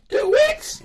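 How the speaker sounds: very high, loud comic squeal; hammed-up falsetto outrage; shocked disbelief. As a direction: shriek it back as an incredulous question. Acts out shock at the wait.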